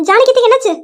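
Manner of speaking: loud, high mock-shocked question; feigned alarm. Nethra fakes shock to Ravi. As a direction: play innocent, alarmed.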